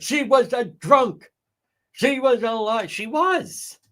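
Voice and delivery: high, drawn-out courtroom mimicry; theatrical imitation; mock dramatic lawyer. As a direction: ham up the prosecutor's line. Mocking Brennan's closing line.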